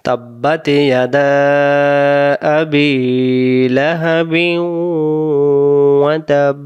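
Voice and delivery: slow, drawn-out Quranic recitation. Shown as a demonstration. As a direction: chant each word slowly and evenly. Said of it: "আরেকবার".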